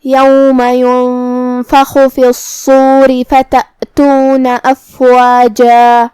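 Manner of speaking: slow chanted Quran verse ending on a stretched افواجا; drawn-out qari recitation; tajweed recitation. Shown as a demonstration. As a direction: recite melodically, stop long on افواجا. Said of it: "افواجہ پہ رکیں گے تو اس پہ مد الف سے رکیں".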